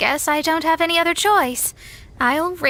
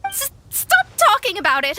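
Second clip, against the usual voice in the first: flustered protest; 6 semitones higher, 4 dB louder than usual. Acted. Flustered Mona cuts Paimon off.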